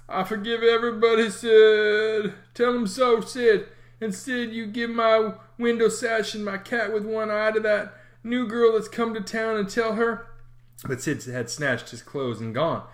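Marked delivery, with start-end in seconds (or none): 0.1-10.2 mock-solemn dying boy. A narrator's reading. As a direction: forgive everyone like on a deathbed. Tom playing dying.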